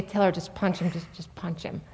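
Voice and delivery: hushed, secretive whisper; whispered urging. Retold or imitated. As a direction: whisper it behind closed doors like a conspiring mom. Mom secretly urging son.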